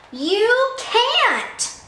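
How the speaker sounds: high loud bully bellow. A narrator's reading. Sammy bellows at Kevin, bully bellow. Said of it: "bellowed Sammy".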